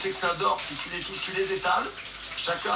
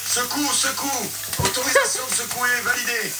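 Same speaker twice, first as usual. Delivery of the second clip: too much caffeine; 6 semitones higher, 3 dB louder than usual. Real, not acted. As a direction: bark orders, buzzing with energy. Hyped cooking orders, shake the pan.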